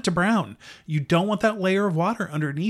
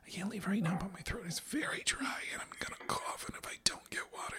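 much softer whisper, half-breathed asides; mock mom whisper; 12 dB quieter than usual. Host mocking co-host while he fetches water, mock-whispered mom monologue. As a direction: whisper a mock mom's inner monologue to the mic.

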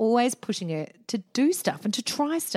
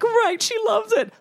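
over-eager; 12 semitones higher, 5 dB louder, slower than usual. Retold or imitated. Sarcastic retold mum.

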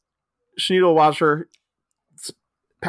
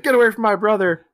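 protective snap; 8 semitones higher, 4 dB louder, faster than usual. Retold or imitated. Jan protecting Todd.

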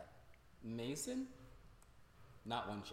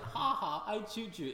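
high, louder jokey lilt; mock joking threat; 7 semitones higher, 4 dB louder, slower than usual. Podcaster showing what the threat was not, high and jokey. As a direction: toss it off as an obvious joke. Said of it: "'Cause it was like".

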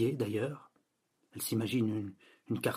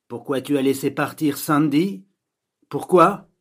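loud melodramatic film dubbing voice; Linda Windsor; 3 semitones higher, 10 dB louder than usual. Acted. Girl showing off a dubbing voice, loud dramatic imitation. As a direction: plead it like a soap heroine, rising on the last word. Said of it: "la doublure de Linda Windsor".

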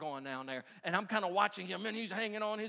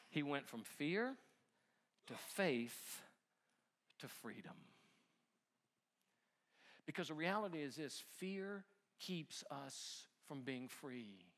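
hushed preacher's closing; solemn sermon point; 6 semitones lower, 8 dB quieter than usual. Acted. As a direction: lay the lesson down gravely, with long pauses. Preaches the lesson slowly.

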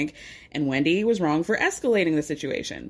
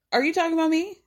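higher, taken-aback retort as Robin; mock indignant surprise; 8 semitones higher, faster than usual. Robin retorting to Candace's lime jab, indignantly surprised. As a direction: play Robin, stung and incredulous.

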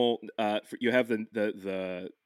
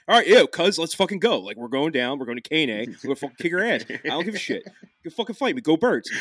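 loud, higher, cocky Philly accent; rowdy Philly street talk, riled up; 5 semitones higher, 4 dB louder than usual. Shown as a demonstration. Philly guy rallying his cousin for a fight, Philly accent. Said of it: "a more Philly accent".

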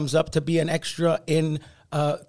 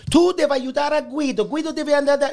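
higher, louder pleading Italian mother; 8 semitones higher, 3 dB louder than usual. Retold or imitated. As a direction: plead like an insistent Italian mama. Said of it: "my mother goes up to Steve Sciarippa".

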